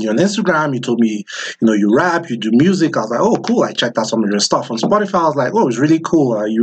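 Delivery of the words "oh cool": ordinary delivery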